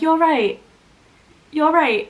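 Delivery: casual greeter. Acted. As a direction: act out a passing greeter's check-in. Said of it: "greeted by someone, they're like".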